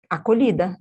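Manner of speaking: ordinary talk, nothing marked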